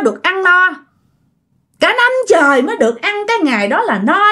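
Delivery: retold spirit complaint, pitched high; storyteller playing an aggrieved ghost; possessing ghost's voice. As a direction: lift the voice high for a ghost making demands and threats. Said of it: "sau đó cũng mới nói vậy nè".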